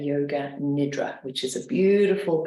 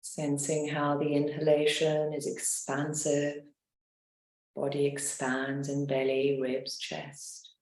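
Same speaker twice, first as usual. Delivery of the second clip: guided meditation; 2 semitones lower than usual. Acted. Leads a guided relaxation.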